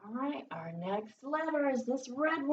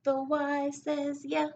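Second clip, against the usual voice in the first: "yeah" sung higher, slowly; sing-song repeat; 5 semitones higher, 5 dB louder, slower than usual. Shown as a demonstration. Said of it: "Every letter makes a sound".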